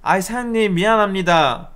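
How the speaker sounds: high, drawn-out apology; ingratiating, singsong mock apology; sweetly affable. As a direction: imitate a mother smoothing things over. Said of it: "아주 사근사근 잘 얘기하시더라고".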